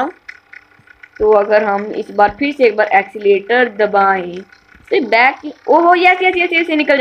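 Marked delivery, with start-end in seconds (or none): 5.7-7.0 higher, eager rapid yeses; excited. Real, not acted. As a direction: cheer the car as it breaks free. Car finally gets unstuck. (ऊँची आवाज़ में उत्साह से "yes, yes")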